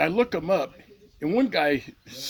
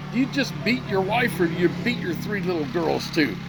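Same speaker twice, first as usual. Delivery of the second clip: angry challenge; 5 semitones higher than usual. Retold or imitated. Confronting the Marine on Facebook.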